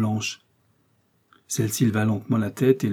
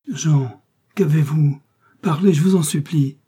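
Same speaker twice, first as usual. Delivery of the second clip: high, loud, panting plea; woman breathlessly begging Jean to speak; breathless pleading; 5 semitones higher, 6 dB louder than usual. A narrator's reading. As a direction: beg him breathlessly to speak.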